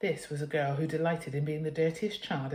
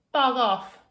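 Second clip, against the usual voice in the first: loud, high defiant bug off; shout back; 7 semitones higher, 8 dB louder than usual. Acted. Girl defying her mother, shouting back. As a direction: yell it back at mum. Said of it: "Gertrude would shout back".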